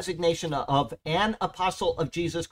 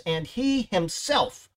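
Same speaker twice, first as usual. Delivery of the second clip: slow, raised stress on "he himself"; emphasis on HE; 4 semitones higher, slower than usual. Shown as a demonstration. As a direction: draw out "he himself" emphatically, pitched up. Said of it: "an emphasis on the word he".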